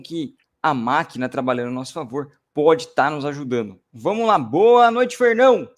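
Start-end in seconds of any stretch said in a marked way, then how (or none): none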